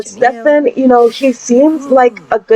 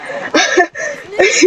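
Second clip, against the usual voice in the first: high cackling laugh; 9 semitones higher, 4 dB quieter than usual. Real, not acted. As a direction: giggle pitched way up. Laughing at her own joke.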